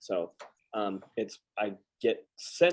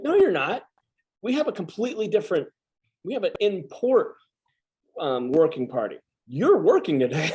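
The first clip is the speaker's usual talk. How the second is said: hammed-up higher officer voice, quick and firm; mock bossy officer; 7 semitones higher than usual. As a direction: shut him down like an officer giving orders. Officer refuses to let him sleep.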